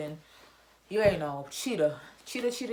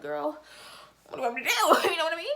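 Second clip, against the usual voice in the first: put-on higher, louder incredulous Girl; exasperated; 10 semitones higher, 6 dB louder, faster than usual. Kid asking the crying sub what to do, exasperated. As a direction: throw up your hands at her. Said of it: "I was like".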